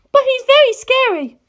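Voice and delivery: put-on a high, fierce girl set on revenge; Penelope's voice. Penelope admits fear.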